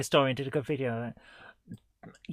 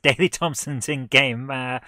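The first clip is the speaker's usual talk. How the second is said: normal talking voice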